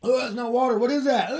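high, squeaky put-on voice; jokey squeal of pretend surprise; mock disgust. Kidding around after grabbing water, mock-disgusted squeak. Joking mock reaction to his drink.

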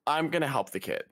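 ordinary talk, nothing marked

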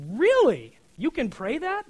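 high, loud incredulous yelp; mock disbelief. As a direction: act shocked someone could pray that. Disbelief at David's psalm prayer.

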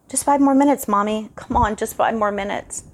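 put-on high, whiny little boy begging; child's pleading. A narrator's reading. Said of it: "the same high-pitched pleading".

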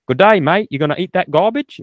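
put-on Australian accent. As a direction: toss off a cheeky Australian impression. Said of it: "He doesn't talk like that".

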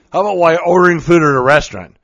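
normal talking voice

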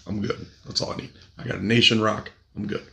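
ordinary talk, nothing marked

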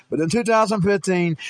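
ordinary talk

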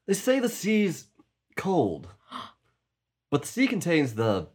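actor impression. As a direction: swell it up like a grand sea threat. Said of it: "my characterization of the actor".

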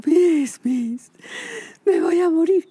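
put-on high, loud desperate child calling Miss; panicked child's cry. Scared boy calling his teacher, high and loud. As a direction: cry out like a terrified little boy. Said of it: "Entonces salió así".

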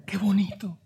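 whispered "how beautiful". Retold or imitated. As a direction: hush it like a tearful viewer.

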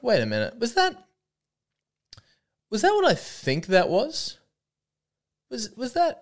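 high disbelieving questions rising up; can't believe what you just saw; incredulous double take. Retold or imitated. Viewer catching the ad, stunned and disbelieving.

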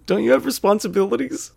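high-pitched incredulous question; mock disbelief. Onlooker judging a weekday morning beer drinker, incredulous. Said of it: "you'd be like".

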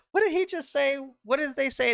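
high, squeaky mock of puzzled fans; confused fans. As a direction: ham up a baffled crowd member. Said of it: "you're gonna get like".

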